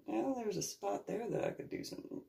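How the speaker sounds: soft, high voice of a private thought; musing to herself. Retold or imitated. Homeowner eyeing a spot in her yard, musing softly.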